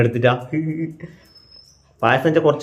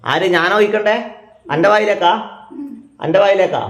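raised, loud voice to be heard; loud calling; 9 semitones higher, 4 dB louder than usual. Real, not acted. Grandson to his hard-of-hearing grandma, loud calling. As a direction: call it out high so grandma hears.

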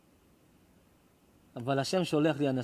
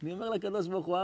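usual speaking voice

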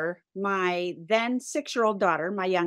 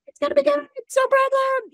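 very high, louder sing-song reassurance; imitated over-bright mom voice, pitched way up; forced cheer; 5 dB louder than usual. Mom reassures daughter outside the store, high forced cheer.

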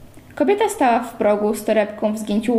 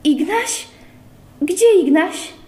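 high, rising, frantic call for Ignaś; anxious cry; 7 semitones higher, slower than usual. A narrator's reading. Pyza frantically asking for little Ignaś, high panicked cry. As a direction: cry out in panic for the missing boy. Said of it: "Pyza wydała bolesny jęk".

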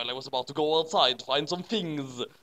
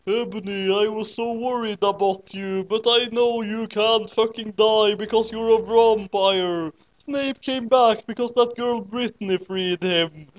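higher teacher voice, calming; reassuring teacher voice; 6 semitones higher than usual. A narrator's reading. Teacher reassuring Ebony, higher and soothing. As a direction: soothe the worried student. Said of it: "Trevor said reassuredly".

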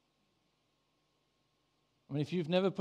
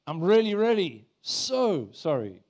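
slow, louder mock pleading; hammed-up apology, stretching "so"; emphatic groveling apology; 3 semitones higher, 6 dB louder, slower than usual. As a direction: grovel slowly, pushing "really" and "so". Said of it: "emphasis on the right words".

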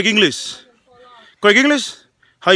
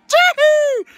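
very high celebratory whoop; excited whoop. Real, not acted. Host cheers on both teams before kickoff, high whoop. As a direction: let out a high, piercing whoop.

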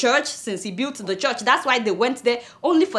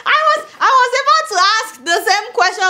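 laughing; 8 semitones higher, 4 dB louder than usual. Real, not acted. Laughing at reader's joke.

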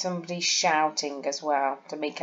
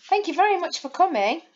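much higher and fast, very rising; excited; 10 semitones higher, faster than usual. Shown as a demonstration. Teacher reads with an exclamation mark, excited and fast. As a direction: gush your thanks. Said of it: "with an exclamation mark".

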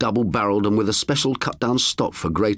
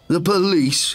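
scoffed; 9 semitones higher, 3 dB louder, slower than usual. A narrator's reading. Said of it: "he scoffed".

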